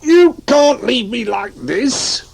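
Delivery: high, slow plaintive wail; a touch of a wail, hammed-up; mild wail. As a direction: whine to be let out. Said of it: "wailed Barnaby".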